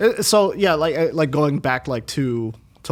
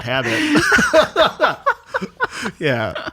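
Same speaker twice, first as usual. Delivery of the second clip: laughing; 14 semitones higher, 4 dB louder, much slower than usual. Real, not acted. Laughing about calling him Frankie.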